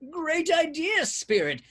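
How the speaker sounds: high, loud yell of triumph. A narrator's reading. Scrooge yelling at the spirit, loud and high. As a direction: bellow it out in glee. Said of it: "Scrooge yelled".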